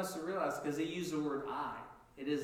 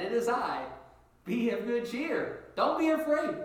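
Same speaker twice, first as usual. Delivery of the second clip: emphatic; 7 semitones higher, 6 dB louder than usual. Acted. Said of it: "That's interesting".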